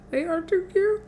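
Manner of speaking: high, soft cutesy voice; cutesy gushing. Real, not acted. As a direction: gush over something adorable. Gushing over cute cereal shapes.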